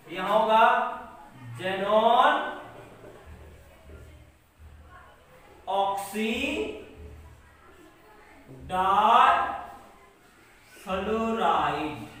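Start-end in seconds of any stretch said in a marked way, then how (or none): 1.6-12.1 drawn-out parts with long pauses; lower, very slow, word by word; slow dictation. Shown as a demonstration. Teacher dictating a compound name for writing, slow dictation.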